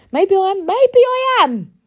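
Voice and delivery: high, loud, drawn-out maybe I am; defiant retort. Real, not acted. To people calling her too emotional, defiant and loud. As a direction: throw it back at them, daring.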